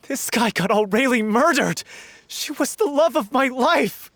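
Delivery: grieving outburst. Acted. Lover accusing the man who got Aurelie murdered, raging. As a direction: let the loss break out as anger.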